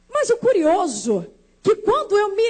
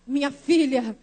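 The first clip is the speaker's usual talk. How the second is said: low, weighty declaration; solemn motherly conviction; 6 semitones lower than usual. Retold or imitated. Mother assuring her crushed daughter, solemn. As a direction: answer the lament with firm faith, voice dropped.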